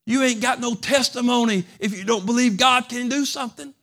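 ordinary delivery

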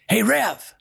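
high, loud call across the room. Retold or imitated. Inmate calls out to the preacher, high and loud. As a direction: call out to get the Rev's attention.

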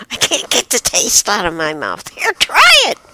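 complaint jumping to a loud, high urging; pleading raccoon. Acted. Raccoon offering the awful food, urgent. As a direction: push it on them, high and loud at the end. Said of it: "the other guy says".

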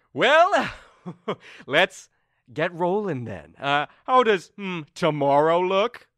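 high, loud, chirpy ruler voice; breezy fake cheer, slow on tomorrow; Turi voiced high. Game master as Turi cheerfully pushing the election on.

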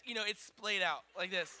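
ordinary talk

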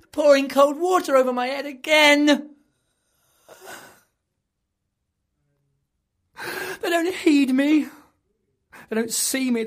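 high, loud desperate wailing; anguished cry. Acted. Tortured madman wails at his tormentors, high and loud. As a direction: plead in agony against the torture.

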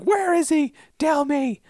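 frantic high-pitched demand; mock hysterical. Retelling a frantic woman demanding answers, mock hysterical. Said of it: "she's kind of hysterical".

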